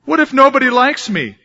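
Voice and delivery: high, loud worried cry; anxious child. Acted. Poem child fears nobody likes him, high anxious. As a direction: blurt out the child's fear.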